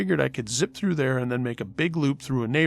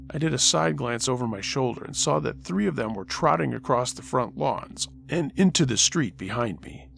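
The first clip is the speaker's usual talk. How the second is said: ordinary delivery, nothing marked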